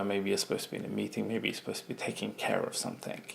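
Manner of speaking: whispered aside. Retold or imitated. Voicing the guilty inner thought.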